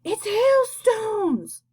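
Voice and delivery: plain reading voice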